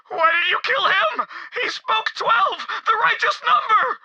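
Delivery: high, upset outcry; distraught protest. Acted. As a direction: protest the killing in anguish. Follower protests the killing.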